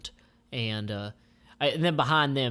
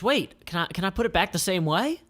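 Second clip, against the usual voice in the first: eager Jackie; 7 semitones higher, 3 dB louder than usual. Acted. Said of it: "And so Jackie says".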